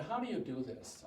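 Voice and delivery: ordinary talk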